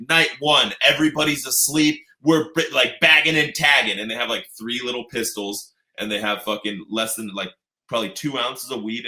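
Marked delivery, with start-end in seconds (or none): 0.1-4.0 higher, louder mock announcement; mock press release. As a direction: announce it like a proud bust.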